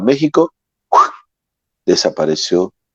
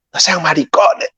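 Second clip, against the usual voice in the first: higher, gruff scolding boatman; rough boatman; 6 semitones higher than usual. Retold or imitated. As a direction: scold the scared boys bluntly. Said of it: "nos dijo una palabra". (pescador regañando a los seminaristas asustados)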